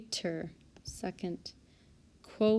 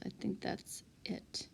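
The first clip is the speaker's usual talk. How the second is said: whisper; 11 dB quieter than usual. Real, not acted. Aside to student near her.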